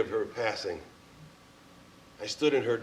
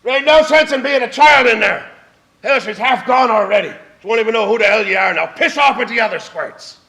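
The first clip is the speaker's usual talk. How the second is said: loud, higher mock yell of a gruff great-uncle; imitating a yell; 10 semitones higher, 14 dB louder than usual. Great-uncle yelling at the boy in the hallway, loud mock yell. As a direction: bellow it down at the kid, half playing it up. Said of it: "stands over me and yells".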